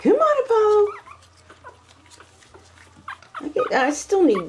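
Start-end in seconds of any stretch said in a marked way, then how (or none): 0.0-1.0 much higher sing-song call. Real, not acted. Owner coaxing Apollo to walk, high sing-song call. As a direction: lure the puppy over sweetly.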